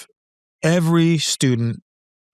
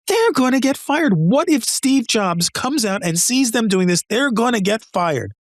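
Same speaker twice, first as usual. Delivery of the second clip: higher, alarmed retelling of a panic; horrified panic; 7 semitones higher than usual. Retold or imitated. New hire frets to the instructor, higher and alarmed. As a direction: fret that they'll be fired, pitched up high. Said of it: "I'm absolutely horrified".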